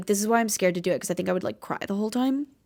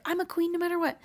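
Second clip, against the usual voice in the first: mock confidence; 9 semitones higher, 4 dB quieter, faster than usual. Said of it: "to just be like".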